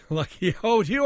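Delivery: laughing mock listener. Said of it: "I hear you say".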